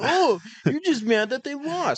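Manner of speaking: high, whiny sneering voice; mocking whiny voice. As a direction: squeak it out like a sore fan.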